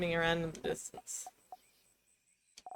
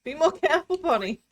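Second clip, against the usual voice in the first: higher, sharper scolding call; yell; 8 semitones higher, 3 dB louder than usual. Acted. Player character yells at reckless Bonnie, worried scolding. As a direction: snap out a worried warning. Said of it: "You can't help but yell".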